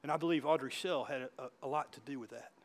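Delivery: ordinary delivery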